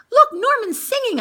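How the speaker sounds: high, loud delighted call; child excitedly pointing. Acted. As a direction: exclaim with glee. Excited exclaim.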